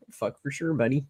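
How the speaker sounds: laughing Northern Ontario bloke saying buddy; imitating a Northern Ontario guy; Northern Ontario accent. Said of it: "my Northern Ontario accent".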